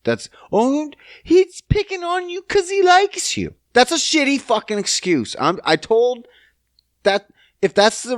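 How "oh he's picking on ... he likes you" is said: high, slow mock-adult voice; squeaky condescending grown-up; mocking old saying. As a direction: parrot the old excuse in a prissy high voice. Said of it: "That's what they used to say".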